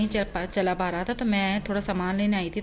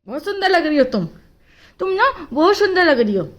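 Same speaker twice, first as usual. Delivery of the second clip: praising the dog; 8 semitones higher, 6 dB louder than usual. Real, not acted.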